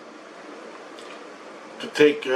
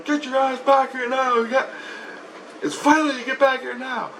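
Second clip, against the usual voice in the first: Christ; 12 semitones higher than usual. Retold or imitated. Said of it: "And Christ goes".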